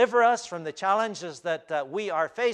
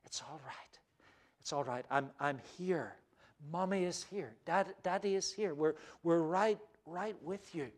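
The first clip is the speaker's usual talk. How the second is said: put-on soft, hushed comfort to a scared child; parent soothing a child; 8 dB quieter than usual. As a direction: hush a distressed child tenderly. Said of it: "like the parent speaking to the child".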